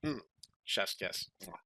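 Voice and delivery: normal talking voice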